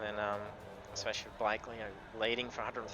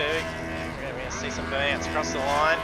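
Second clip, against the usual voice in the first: loud, driven race call; race commentary; 3 semitones higher, 5 dB louder than usual. Real, not acted. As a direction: call the finish like a live broadcast.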